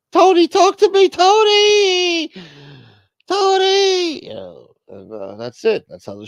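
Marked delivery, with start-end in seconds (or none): 0.1-4.2 high, loud hammed-up wailing wife; wife crying out. Wife on the floor begging her husband, hammed-up wail. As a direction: wail his name over and over. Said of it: "you see Carmelo on the floor, fucking".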